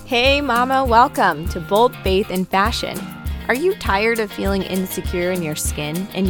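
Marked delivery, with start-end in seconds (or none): none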